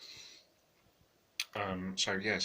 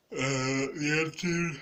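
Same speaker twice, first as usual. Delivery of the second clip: words stretched through a yawn; 7 semitones higher, 6 dB louder, much slower than usual. Real, not acted. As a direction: yawn while saying it. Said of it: "I'm yawning away".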